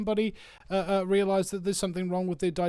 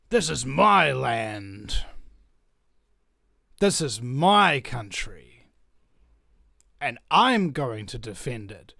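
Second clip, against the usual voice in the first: slow, low-pitched declaration; solemn vow; 5 semitones lower, much slower than usual. Real, not acted. Vlogger vowing to defend the land, solemn and slow. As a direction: weigh each word like an oath.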